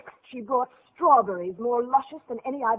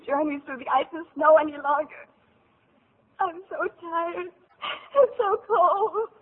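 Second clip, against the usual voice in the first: tearful; 6 semitones higher, 5 dB louder than usual. Acted. Marushka weeping exhausted.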